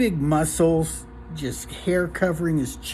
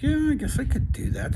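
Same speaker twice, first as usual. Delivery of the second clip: higher, lightly voiced young guy; agreeable young bartender; 12 semitones higher than usual. Young bartender answering the owner's warning, high and agreeable.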